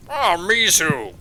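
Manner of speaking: loud, drawn-out delighted greeting; doting father king. Acted. Father greeting his returning daughter, delighted. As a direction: raise the voice and stretch it, fatherly.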